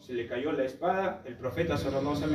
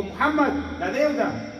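high, loud imitated shout; grito retado de cobro; 8 semitones higher, 4 dB louder, slower than usual. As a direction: call out a demand for payment. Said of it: "empezó a gritar y dijo". (grito exigiendo una deuda)

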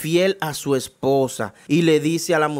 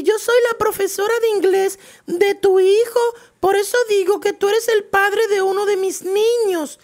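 much higher, loud offended woman; indignant teacher; 8 dB louder than usual. Acted. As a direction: push back, shocked and irritated, in a high voice. Confused teacher answers father.

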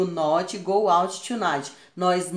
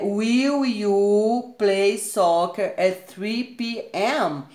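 very slow, careful teacher reading; slow, deliberate example question; slow model question; 3 semitones higher, 4 dB louder, much slower than usual. Shown as a demonstration. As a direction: lay out every word for the learners. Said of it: "o will, ele vem no início da frase".

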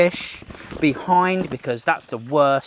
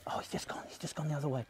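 hushed whisper so the lizard isn't scared; 17 dB quieter, faster than usual. Real, not acted. Whispering to viewers beside a hiding skink, hushed. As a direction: breathe it out, barely voiced.

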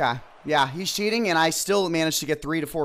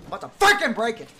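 higher and much louder, fast at first; furious outburst; 12 semitones higher, 8 dB louder than usual. Real, not acted. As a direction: threaten to smash the controller. Raging at broken controller.

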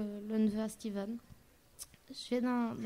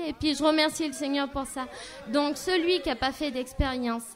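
fervent testimony; 2 semitones higher, 3 dB louder than usual. Acted. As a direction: urge the congregation to take a step. Closing testimony exhortation.

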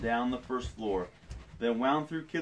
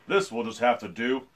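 dad voice; 6 dB louder than usual. Acted. Dad character quote in story.

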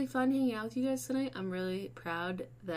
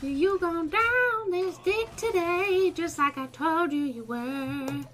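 slow, high, loud gloat; gloating taunt; 7 semitones higher, 7 dB louder, slower than usual. Real, not acted. Gamer taunting the opponent after winning, slow gloat. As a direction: rub the win in slowly.

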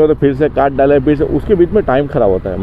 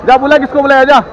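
high, raised-voice challenge; angry dare; 8 semitones higher, 4 dB louder than usual. Real, not acted. Rider daring pump worker to fetch someone, angry. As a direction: dare him to go, loudly.

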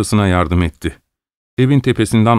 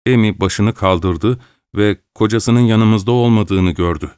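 ordinary delivery, nothing marked

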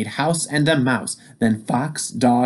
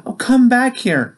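calling out; 11 semitones higher, 4 dB louder than usual. Acted. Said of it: "called Sam".

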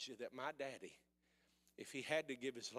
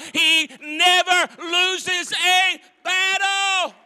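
slow, far louder and higher preacher's shout with a laugh; full-force preaching; 14 semitones higher, 22 dB louder, much slower than usual. Acted. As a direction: shout it to the back row, slow.

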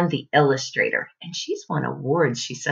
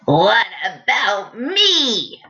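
slow, louder snapping crocodile; snapping grinning crocodile; 3 semitones higher, 5 dB louder, much slower than usual. A narrator's reading. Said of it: "snapped the crocodile".

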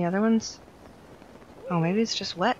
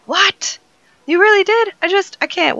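much higher and louder, amazed; stunned disbelief; 13 semitones higher, 12 dB louder than usual. Acted. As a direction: gasp out the shock. NPC voiced in disbelief.

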